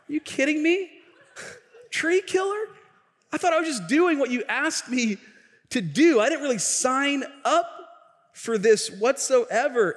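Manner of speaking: high, incredulous protest; can't believe he's called a tree killer; a kid outraged at being labeled; indignant disbelief. Retold or imitated.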